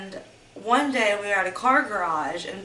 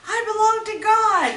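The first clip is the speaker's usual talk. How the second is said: much higher, much louder desperate cry; retold crying scream; 13 semitones higher, 5 dB louder than usual. Her screaming back at the abuser, crying and loud. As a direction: scream it out through tears. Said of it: "screaming, crying".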